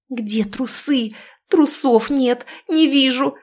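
rising, frantic muttering; frantic panic. A narrator's reading. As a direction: hunt for them in a panic. Frantic search for underwear.

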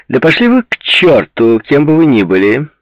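loud, high-pitched telling-off; exasperated shout down the phone; angry outburst. A narrator's reading. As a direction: blow up at a silent caller. Fed-up swearing at silent caller.

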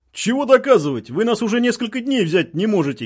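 high-pitched indignant calling; heated retort. A narrator's reading. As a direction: throw it back loudly across the lines. Militants argue back at loudspeaker.

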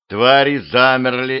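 ordinary delivery, nothing marked

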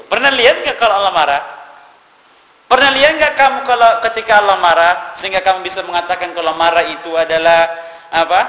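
loud, high, forceful sermon voice; fiery preaching. Acted. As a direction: thunder the challenge at the congregation. Preacher rebukes misinterpretation loudly. (khotbah berapi-api, suara keras dan tinggi)

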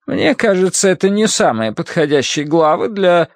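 high-pitched prim protest; objecting woman. Acted. Bible reader doubting the patient's chosen chapter, high-pitched protest.

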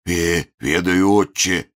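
slow frightened wheeze; wheezing. Acted. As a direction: wheeze it out in fear. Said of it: "просипел Еропкин".

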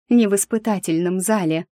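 ordinary delivery

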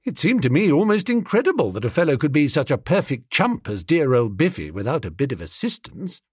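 ordinary delivery

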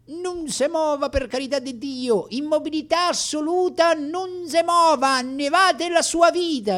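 shrill-pitched begging to keep still; imitating the doctor, pleading. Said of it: "E aggiungeva poi".

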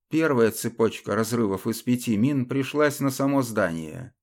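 ordinary delivery, nothing marked

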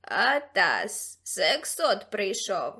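slow, drawn-out creature croak; Ксимеріус cawing. A narrator's reading. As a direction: caw out a warning. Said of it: "прокаркав Ксимеріус".

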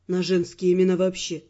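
low, unruffled man's voice; unblinking admission. A narrator's reading. Earl admitting to the heroine his bad memory, low and unabashed. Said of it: "не моргнув глазом, признался Эрл".

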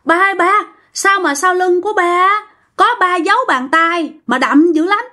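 put-on high-pitched, startled child calling out to dad; acted alarm, pitched well up; alarmed cry. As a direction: blurt it out in shocked disbelief. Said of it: "bạn mới la lên".

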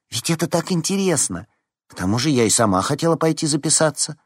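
high-pitched, light and eager; smiling enthusiasm. A narrator's reading. Veronika cheering up classmates about the contest, smiling. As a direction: chirp it keenly with a smile. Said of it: "улыбнулась Вероника".

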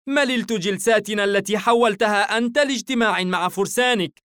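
high-pitched, exasperated tirade; indignant cry in someone's face; angry outburst. A narrator's reading. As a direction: raise the pitch and vent fed-up anger. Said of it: "صرخت نارا بوجهه غاضبة".

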